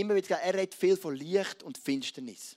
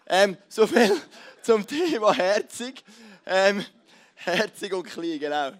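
laughing; 4 semitones higher, 10 dB louder than usual. Real, not acted. Laughs at own punchline.

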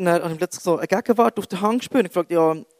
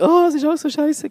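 high-pitched mock moaning; bleating complaint; 10 semitones higher, 4 dB louder than usual. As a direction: bleat it out like a grumbling sheep. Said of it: "dass dir immer viel blökt und sagt".

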